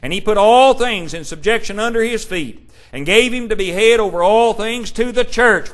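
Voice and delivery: loud, ringing pulpit proclamation; preaching at full force. Acted. Preacher proclaiming Christ's power to the congregation, full-force preaching. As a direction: thunder it out over the congregation.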